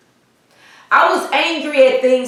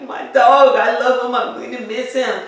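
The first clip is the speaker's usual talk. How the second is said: imitated crying. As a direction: wail lightly, as if reliving the tears. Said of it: "I just was crying and crying".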